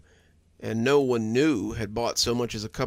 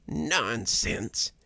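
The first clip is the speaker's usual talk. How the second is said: put-on rasping old woman; raspy. A narrator's reading. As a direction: rasp it out, weak and scornful. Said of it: "she rasped".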